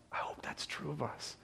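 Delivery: soft, whispered wondering; hushed hope. Real, not acted. Preacher hoping this of his congregation, hushed whisper. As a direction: drop to a whisper, as if confiding.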